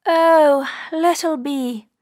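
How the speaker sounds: high, plaintive rabbit voice; pleading little rabbit. Acted. Rabbit appeals to the bee.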